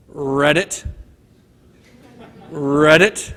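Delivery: a frog croaking like ribbit. Acted.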